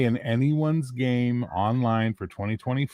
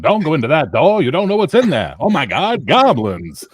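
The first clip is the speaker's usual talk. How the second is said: put-on louder old radio drama voice; mid-Atlantic accent; 4 semitones higher, 6 dB louder than usual. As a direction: play it like a forties radio serial. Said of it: "done in the mid-Atlantic accent".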